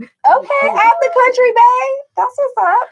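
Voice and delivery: high, loud cheering for her friend; excited hype. Real, not acted. Cheering on a friend visiting her boyfriend abroad, loud excited hype.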